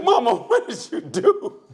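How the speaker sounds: high, drawn-out cry through laughter; hammed-up slow, high dismay; mock horrified son. Son to mother who just shot the man, mock horrified. Son's shocked reaction, retold amid laughter.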